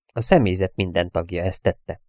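usual speaking voice

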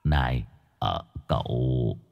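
hushed, low hesitant opener; guilty, tentative murmur; timid. Acted. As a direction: speak up sheepishly, very softly. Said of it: "Ông Cường rụt rè".

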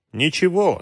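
a touch of anger in a private thought; understated sudden spite. A narrator's reading. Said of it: "с неожиданной злостью подумал". (сдержанная внезапная злость, повыше)

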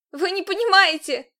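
high, loud protest; shouting. A narrator's reading. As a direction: cry out while struggling. Captive protesting while dragged.

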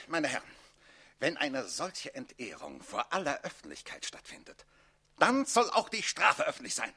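ordinary talk, nothing marked

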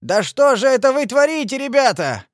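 high, loud cry of protest; indignant yell; appalled shout. A narrator's reading. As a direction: cry out in outrage at the lads. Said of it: "крикнул он".

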